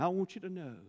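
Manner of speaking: high, loud revival preaching; fiery southern preacher climax; preaching at full force. Acted. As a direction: drive it home to the congregation. Sermon climax builds.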